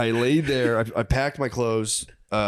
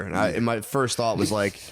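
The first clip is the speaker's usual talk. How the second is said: ordinary talk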